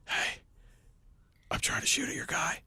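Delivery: strained hoarse whisper, voice gone; voiceless whisper. Retold or imitated. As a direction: whisper it like your voice is shot. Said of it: "I could only whisper".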